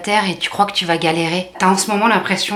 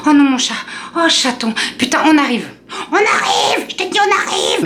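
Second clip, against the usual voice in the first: frantic friends; 6 semitones higher than usual. Retold or imitated. Girlfriends rushing to help.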